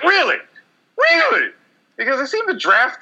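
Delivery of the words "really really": much higher disbelieving Really; mock outrage, pitch shooting up; incredulous. Retorting to critics who mocked his Biggio pick. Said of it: "I'm like".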